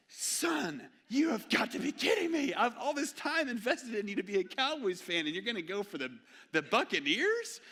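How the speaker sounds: high, loud disbelieving yell; exasperated shout. Retold or imitated. Dad exasperated at son's choice.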